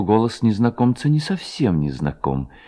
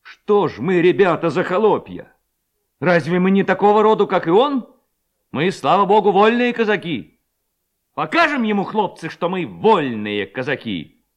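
high, raised rallying cry; rousing speech; 8 semitones higher, 3 dB louder than usual. A narrator's reading. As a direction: fire up free Cossacks to act. Levko's rousing speech to lads. (зажигательный призыв повышенным голосом)

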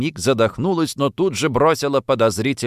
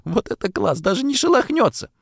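suppressed glee; 11 semitones higher than usual. A narrator's reading. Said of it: "от едва сдерживаемого смеха".

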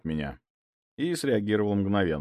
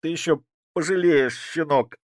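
nasal, through a broken nose; nasal threat; 8 semitones higher, 6 dB louder than usual. A narrator's reading. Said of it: "прогундосил коренастый". (гнусаво, с разбитым носом)

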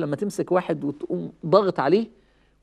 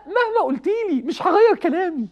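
mock stubborn reply; 12 semitones higher than usual.

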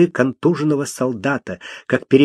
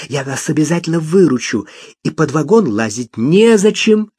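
excited cry; 6 semitones higher than usual. Acted. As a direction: blurt it out in a rush. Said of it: "крикнула".

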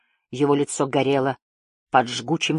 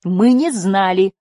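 slow, louder, higher-pitched repetition; emphatic echo; 4 semitones higher, 5 dB louder, slower than usual. A narrator's reading. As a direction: insist on it, stretching each word. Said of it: "повторила Жасмин вслед за Лифом". (твёрдое, растянутое повторение)

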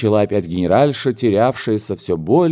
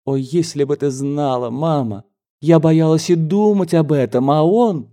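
put-on gushing young daughter; 6 semitones higher than usual.

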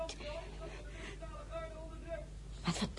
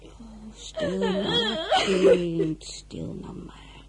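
soothing; 10 semitones higher, much slower than usual. Acted. Maid soothing crying girl.

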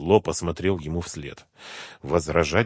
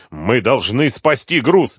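slow, raised, desperate insistence; 6 semitones higher, 7 dB louder, slower than usual. A narrator's reading. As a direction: insist loudly, in despair. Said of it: "метался в отчаянии Мурат". (отчаянно, громко)

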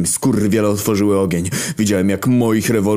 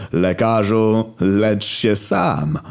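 raised, very slow battle cry; drawn-out cry; 4 semitones higher, much slower than usual. A narrator's reading. Rebel giving the signal for revolution, slow raised cry. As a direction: call it out slowly, stretching the words. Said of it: "krzycząc".

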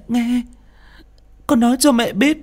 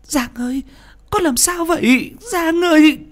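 high, panicked calling that gets louder; frightened mother calling her raging son; alarmed cry; 4 dB louder than usual. Acted. Mother startled as son rages.

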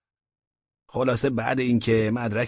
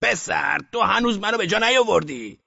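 put-on higher, louder outburst at his old friend; snapping shout at a friend who doesn't recognize him; sudden shout; 8 semitones higher, 3 dB louder than usual. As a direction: cut in and shout it out. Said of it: "یک دفعه حرفش را برید و داد زد".

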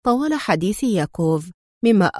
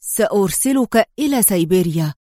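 ordinary delivery, nothing marked

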